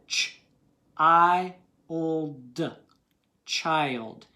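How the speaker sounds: put-on "Ch", "I", "old", then "child", each segment said separately with the long I punched loud; sounding out child. Shown as a demonstration. Teacher sounding out "child" for students, slow and segmented. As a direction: blend the sounds of "child" slowly, hitting the long "I". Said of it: "Listen to it".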